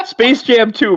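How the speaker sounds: laughing out the title; giggling through the words. Real, not acted. Laughing at movie mention.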